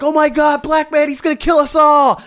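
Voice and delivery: high, loud imitation of alarmed bystanders; hammed-up cry of alarm; mock panic. Bystanders fleeing the screaming runner, mock panic. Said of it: "these Thai people are, like, running".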